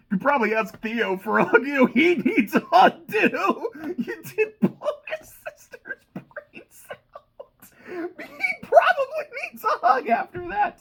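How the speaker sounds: high, loud laughter through the words; real helpless laughing, voice squealing upward; cracking up. Real, not acted. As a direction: lose it laughing while getting the line out. Cracks up at own dark joke.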